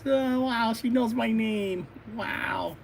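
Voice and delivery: high, drawn-out acted wonder; amazed hero. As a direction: marvel like a starstruck boy hero. Smitten hero amazed she knows him.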